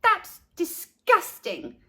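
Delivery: high, slow, grossed-out verdict; disgusted. A narrator's reading. Leslie judging Carla's sandwich, slow high disgust. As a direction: stretch out the yuck, tongue out. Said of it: "sticking out her tongue".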